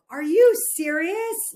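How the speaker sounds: high, slow mock disbelief; husband at his wife's mistake, high and slow; incredulous husband. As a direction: play the stunned husband, drawn out. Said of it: "my husband was like".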